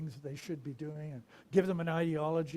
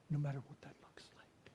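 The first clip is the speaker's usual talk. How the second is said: soft whisper; 2 semitones lower, 7 dB quieter than usual. Real, not acted. Whispered sermon end.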